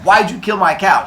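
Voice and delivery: acted furious demand; angry yelling. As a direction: confront them in outrage. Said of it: "start yelling back and forth".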